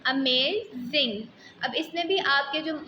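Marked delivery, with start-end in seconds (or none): 0.1-1.2 अमेजिंग said slowly, each syllable drawn out; slow pronunciation demo. Shown as a demonstration. Teacher asks students to pronounce, says अमेजिंग slowly. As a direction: model अमेजिंग slowly for the class to copy. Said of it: "इसको pronounce करें".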